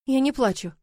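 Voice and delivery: ordinary delivery, nothing marked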